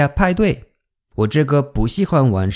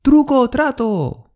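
put-on high excited child's call; 孩子敲门喊; kids calling out; 10 semitones higher than usual. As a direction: chant it like a kid at the door. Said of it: "小孩子来我家敲门喊".

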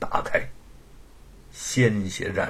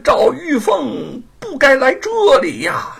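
flustered stammer; 6 dB louder than usual. Acted. King of Hell fumbling before the angry Monkey King. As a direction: stammer like a frightened official. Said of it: "阎王慌里慌张地".

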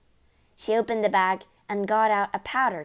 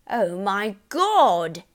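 loud, drawn-out exclamation; horrified yell; 7 dB louder, much slower than usual. A narrator's reading. Father seeing himself in mirror, yelling. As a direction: stretch it out in horror. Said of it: "yelled the father".

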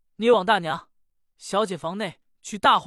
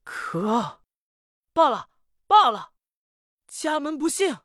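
raging master; 8 semitones higher than usual. Acted. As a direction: explode in despair and fury. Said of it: "暴跳如雷".

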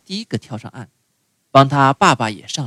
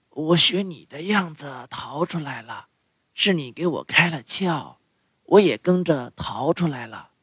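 tuna voice; 3 semitones higher, 6 dB quieter than usual. A narrator's reading. Tuna speaks.

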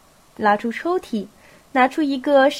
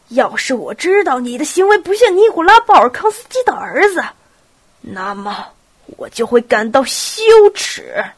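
piercing shout; 7 dB louder than usual. A narrator's reading. Old prince rebuking his departing son, piercing shout. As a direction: cry out sharply, voice jumping up. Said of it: "接着又突然用刺耳的声音大声说".

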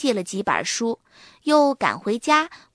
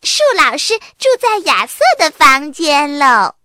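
louder, clapping, teasing cheer; gleeful shout; 5 semitones higher, 9 dB louder than usual. A narrator's reading. Little sister teasing Arthur with a gleeful shout. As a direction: crow it out while clapping. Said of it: "拍着手喊着".

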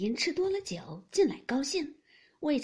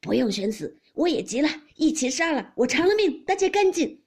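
loud shouting that climbs higher; enraged threat; 6 semitones higher, 9 dB louder than usual. A narrator's reading. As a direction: threaten them loudly, building phrase by phrase. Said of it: "贾琏气得墙上拔出剑来". (气急败坏地大声威胁)

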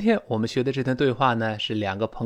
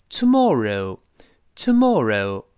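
slow British "tomorrow", rounded mor and reh-oo ending; British pronunciation; 4 dB louder, much slower than usual. Shown as a demonstration. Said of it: "英国人是".

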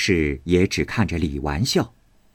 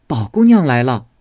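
servant's announcement; 5 semitones higher, 5 dB louder than usual. A narrator's reading. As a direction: call out a visitor's arrival. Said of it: "只听人报". (高声通报)

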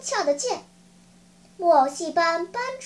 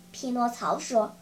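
plain reading voice

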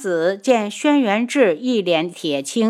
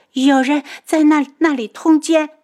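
high, loud frightened disclosure; panicked; 8 semitones higher, 5 dB louder than usual. A narrator's reading. Kneeling maid confessing what she saw, panicked. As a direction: blurt it out in high-pitched alarm. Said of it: "宫女就惊慌失措地冲了进来".

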